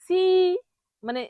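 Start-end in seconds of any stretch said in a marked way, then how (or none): 0.0-0.2 সি with the vowel stretched, "siii"; wrong-way demo of সি, overlong ending; common mispronunciation. Shown as a demonstration. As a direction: draw out the i in সি. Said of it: "এটাকে ভুল করে অনেকেই বলে".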